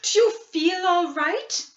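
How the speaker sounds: put-on a mother checking her sick child; worried mother. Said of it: "she asked".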